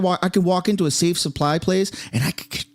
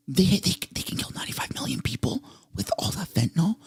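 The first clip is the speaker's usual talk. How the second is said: hushed, stammering disbelief; stunned; 6 dB quieter than usual. Real, not acted. Streamer taking in fentanyl figure, stunned. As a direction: drop to a near-whisper, stumbling in shock. Stunned at the lab's deadly potential.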